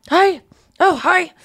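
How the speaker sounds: high, loud nervous greeting; sheepish hungover hello; awkward hungover hi. Retold or imitated. Hungover Rachel greeting Ross, awkward and high. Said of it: "She's just like".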